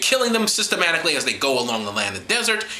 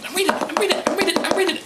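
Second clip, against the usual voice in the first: much higher, insisting; high protest; 13 semitones higher than usual. Real, not acted. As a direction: squeak I'm reading in defense. Defensive squeak.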